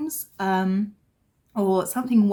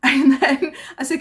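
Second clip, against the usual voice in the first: laughing; 5 semitones higher, 9 dB louder than usual. Real, not acted.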